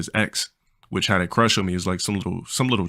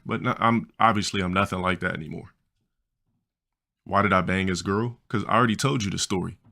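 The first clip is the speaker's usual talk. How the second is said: ordinary talk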